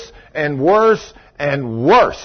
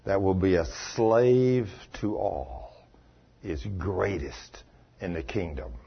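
solemn, gentle teaching; 7 semitones lower, 9 dB quieter than usual. Retold or imitated. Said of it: "Jesus said".

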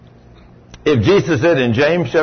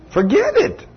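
scornful dismissal. Real, not acted. Preacher dismissing weak prayer.

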